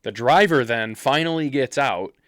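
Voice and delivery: ordinary talk, nothing marked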